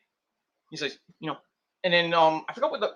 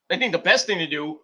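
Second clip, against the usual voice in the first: usual speaking voice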